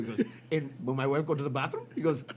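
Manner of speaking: put-on a light Indian accent, gleeful by the end; doctor confessing, a touch of Indian accent. Doctor admits to salting the chips, in a light Indian accent. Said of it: "Your impression of Indian guys".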